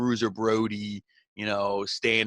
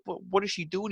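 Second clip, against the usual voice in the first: much higher, mock baffled wrestler; lightly acted disbelief, pitched up; retold bewilderment; 9 semitones higher than usual. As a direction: play the confused guy asking the ref. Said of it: "He's like".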